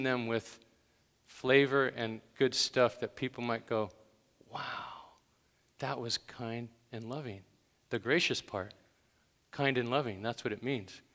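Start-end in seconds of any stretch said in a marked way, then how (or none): 4.5-7.4 breathy, whispered amazement; hushed awe. Acted. Listeners reacting to kind, gracious words, hushed awe. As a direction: let it out as a soft, amazed breath.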